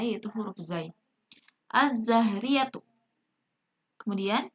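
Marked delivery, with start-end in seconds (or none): 1.7-2.8 slow "az-zahriyatu" with a long Z; silent lam. Shown as a demonstration. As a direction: read "az-zahriyatu" deliberately, lam merged into Z. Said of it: "Alif lamnya itu tidak dibaca jelas".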